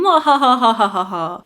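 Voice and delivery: evil cackle, mock villain laugh; mad scientist. Bear announces takeover, evil laugh.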